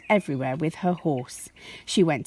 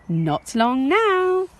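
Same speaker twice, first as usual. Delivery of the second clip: a higher, slow, soothing parent voice; slow parental reply; 8 semitones higher, 4 dB louder, much slower than usual. Acted. Parents soothe Josie, slow higher reply. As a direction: stretch it out to calm an eager child.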